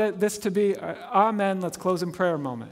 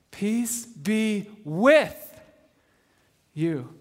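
slow, with stretched and raised; stress on with; much slower than usual. Shown as a demonstration. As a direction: draw out with, then let you fall. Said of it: "let the word that I emphasize hit you".

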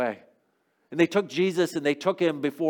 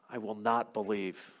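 grave refusal; 4 semitones lower, 9 dB quieter, slower than usual. Acted. Thomas's firm vow, slowed.

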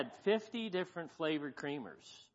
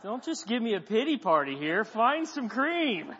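higher, louder mock-frustrated plea; frustrated inner outburst; 7 semitones higher, 5 dB louder than usual. Preacher voicing his thoughts at the helpful lady, frustrated. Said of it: "I'm kinda frustrated".

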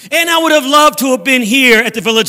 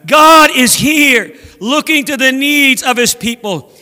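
calling out; slower than usual. Acted. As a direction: call it out to everyone, stretching the first words. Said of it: "they started calling out among themselves".

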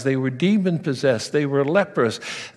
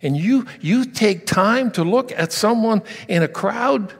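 frustrated father; 6 semitones higher than usual. Retold or imitated. Desperate father demanding why Jesus stopped, rising to a shout. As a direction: start hurt and climb to a near shout. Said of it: "can you imagine Jairus' attitude".

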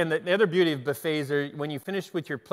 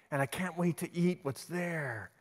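usual speaking voice

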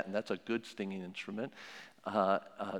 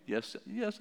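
put-on much higher little child voice; child's voice; 10 semitones higher than usual. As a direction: answer like a small kid caught out. Child answering parent in role-play.

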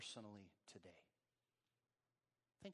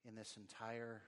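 soft, subdued prayer with long pauses; 2 semitones lower, 5 dB quieter than usual. Real, not acted. Preacher praying for congregation to live free, hushed prayer. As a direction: pray quietly, letting each phrase settle.

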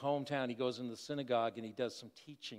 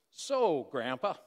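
commanding; 6 dB louder, slower than usual. Retold or imitated. Grandson addressing his grandpa at dinner, mock commanding. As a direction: announce it like a small boss, punched out. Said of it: "in a very commanding voice".

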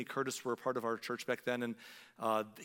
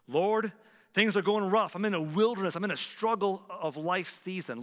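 higher and louder, urgent plea; pleading cry; 6 semitones higher, 8 dB louder than usual. Pretend. Preacher voicing a struggling believer, loud pleading cry. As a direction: call out to God from the wilderness.

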